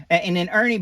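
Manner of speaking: usual speaking voice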